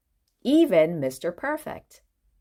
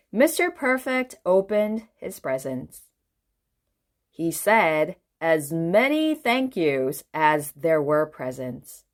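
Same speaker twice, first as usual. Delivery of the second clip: ordinary delivery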